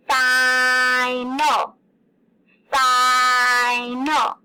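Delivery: final with a long loud I; held-out syllables. Shown as a demonstration. Teacher holding out the I in final for kids. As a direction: stretch the I in final, fiii-nal. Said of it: "I was holding out that I sound".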